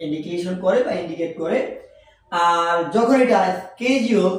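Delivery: ordinary talk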